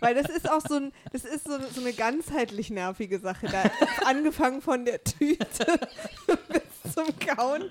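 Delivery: redet lachend weiter. Real, not acted. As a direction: spricht mit Lachen. Lacht beim Reden. (lachend erzählt)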